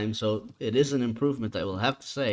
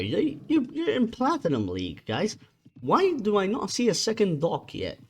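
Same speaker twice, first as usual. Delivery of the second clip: exasperated; 8 semitones higher than usual. Real, not acted. Caster exasperated at players.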